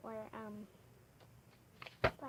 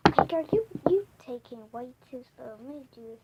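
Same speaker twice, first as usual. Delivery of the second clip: impatient; 2 semitones higher, 9 dB louder than usual. Real, not acted. Impatient older sister takes over.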